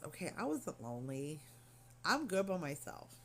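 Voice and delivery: normal talking voice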